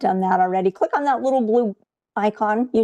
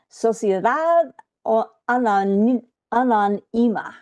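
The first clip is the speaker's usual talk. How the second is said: best Spanish pronunciation. Shown as a demonstration. Instructor attempts an Argentinian company's name for the class, Spanish pronunciation. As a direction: sound out the name in Spanish, haltingly. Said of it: "do my best Spanish pronunciation".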